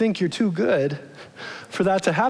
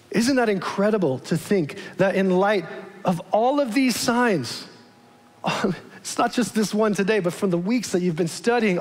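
ordinary delivery

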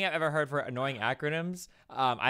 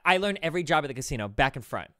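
ordinary talk

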